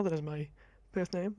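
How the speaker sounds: ordinary delivery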